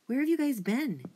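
usual speaking voice

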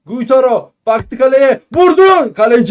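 rising shout on the shot; excited shot call. Real, not acted. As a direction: build to a yell as he shoots. Shot on goal, live call. (heyecanla şut anlatımı)

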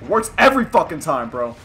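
loud gloating burst; too intense. Real, not acted. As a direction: boast it hard and loud. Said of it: "Sorry, I'm getting a little too intense".

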